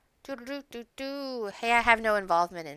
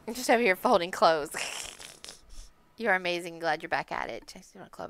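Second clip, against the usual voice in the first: ordinary talk, nothing marked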